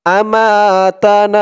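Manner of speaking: slow আমাতানা with held long a vowels. Shown as a demonstration. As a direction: stretch "maa" and "naa" in আমাতানা. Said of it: "না এক আলিফ টান".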